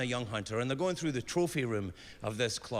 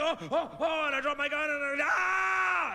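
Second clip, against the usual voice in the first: high rising scream of panic; terrified shout; 12 semitones higher than usual. Acted. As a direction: build from yelps to a full scream. Dropping gun in terror.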